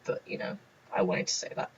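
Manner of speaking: soft whispered confession; whispered aside. Real, not acted. To the viewers, a whispered admission. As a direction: drop to a whisper for the secret.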